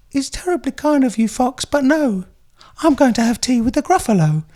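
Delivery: high, prim mouse voice; a very proper little mouse, politely declining; posh little mouse. Acted. Reading The Gruffalo aloud, a posh high mouse voice. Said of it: "the mouse turns around and says".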